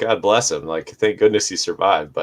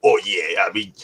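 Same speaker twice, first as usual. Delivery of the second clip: imitating the bear survivor's thick drawling accent; 4 semitones higher than usual. Mimicking the polar bear survivor's thick accent to friends. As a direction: put on his thick accent and tell the bear story. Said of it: "was pretty much exactly how he sounded".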